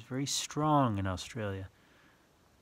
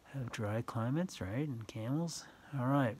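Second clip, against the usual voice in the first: usual speaking voice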